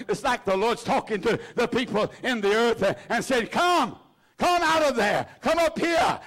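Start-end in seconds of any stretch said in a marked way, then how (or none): 3.5-6.2 the Lord shouting the dead out of the grave; commanding shout. Acted. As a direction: cry out like God calling the dead up.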